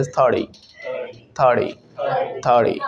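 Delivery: "थर्टी" said as thur-dee; t as light d. Shown as a demonstration. Teacher demonstrates American sound, "थर्टी" with a light d. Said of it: "टी में हल्का सा डी add".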